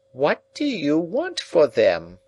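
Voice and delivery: high, slow, pressing repeat; insistent old woman. A narrator's reading. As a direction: press the question again, slowly.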